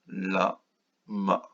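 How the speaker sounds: "Lama" softly, with a long gap between la and ma; syllable by syllable. Shown as a demonstration. Teacher checking children's drawn syllables, "Lama" broken in two. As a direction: isolate each syllable of "Lama". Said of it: "le temps de les dessiner".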